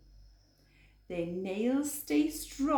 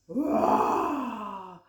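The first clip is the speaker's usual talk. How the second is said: a lightly growled roar; growling bear; 5 dB louder than usual. Acted. Bear roaring at the animals, lightly growled roar. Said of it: "growled the bear".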